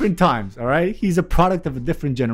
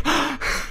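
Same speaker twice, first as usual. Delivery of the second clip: sharp high gasp of alarm, caught out; mock gasp; 10 semitones higher, 5 dB quieter than usual. Retelling dad reaching the police at his car, sharp mock gasp. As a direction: gasp like you just got caught.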